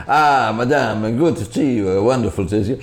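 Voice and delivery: effusive restaurant host. Retold or imitated. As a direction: gush a big welcome to the guest. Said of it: "people would come into the restaurant".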